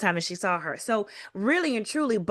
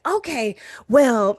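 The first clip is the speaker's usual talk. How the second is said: higher, louder mock huff; raised voice; 3 semitones higher, 5 dB louder, slower than usual. Cynthia dialing up the pressure on the doctor, raised voice. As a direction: raise your voice as if fed up. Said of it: "and she raises her voice".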